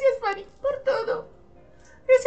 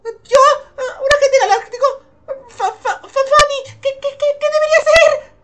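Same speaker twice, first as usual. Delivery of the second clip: high, stammering disbelief; flustered; 14 dB louder than usual. Acted. Girl offered galactic agent job asks Fanny, flustered. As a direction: stumble over the words, pitched up.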